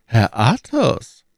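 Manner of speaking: put-on high, strained old cardinal. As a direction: raise the voice into the cardinal's pitch. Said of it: "sagte Richelieu".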